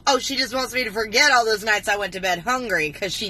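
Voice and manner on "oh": normal talking voice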